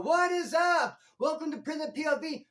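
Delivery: loud, high upbeat channel intro; hyped intro. Shown as a demonstration. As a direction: shout it like a pumped host. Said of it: "come on and be all".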